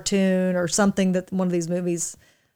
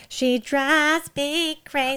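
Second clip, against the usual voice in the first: high, slow nasal imitation; nasal singer voice; 7 semitones higher, much slower than usual. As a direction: hold your nose and sing it. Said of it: "He has that really nasally voice".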